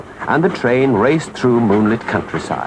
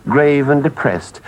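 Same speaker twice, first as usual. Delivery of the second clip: ordinary delivery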